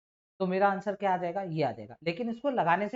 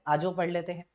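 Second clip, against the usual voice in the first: ordinary talk, nothing marked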